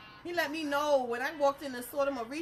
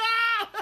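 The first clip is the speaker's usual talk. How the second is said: loud, high comic scream; retold yell; 11 semitones higher, 10 dB louder than usual. Teller acting out hitting the floor, loud comic yell. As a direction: yell it high, then burst out laughing. Acts out own cry in story.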